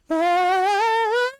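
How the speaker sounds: high shrill held creature cry; imitated Pokemon cry. Gamer showing the best Pokemon cry, high held shriek. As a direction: belt out the game's cry. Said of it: "the best cry is".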